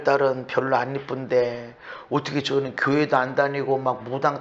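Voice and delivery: 낮게 읊조리는 원망 흉내; 하나님께 푸념하는 척, 나직하게; 하소연 흉내. Acted. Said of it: "막 이렇게 내놓고 말은". (낮은 목소리로 투덜대는 부모 흉내)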